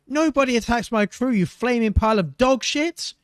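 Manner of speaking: high, loud furious yell; swearing attacker. Acted. Pirate captain attacking the guard in the dark, furious yell. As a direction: curse him out at full volume. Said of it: "the attacker swore".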